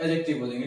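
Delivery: एडजेक्टिव low and soft, d silent; spoken-English एडजेक्टिव with silent d; correct pronunciation. Shown as a demonstration. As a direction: say एडजेक्टिव as ajective. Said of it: "एडजेक्टिव नहीं बोलेंगे".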